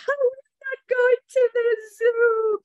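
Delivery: high, loud hammed-up wail; mock crying child. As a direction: wail like a disappointed pupil. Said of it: "It's not for crying".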